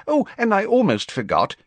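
high, fussy official voice; a busy minister brushing it off; Fudge's voice. Acted. Fudge tells the Prime Minister about Dumbledore in a high fussy voice.

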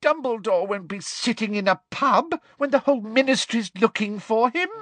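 high, loud exasperated scolding; furious woman, pitched right up; angry shout. A narrator's reading. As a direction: explode at the stupid answer. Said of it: "shouted Umbridge".

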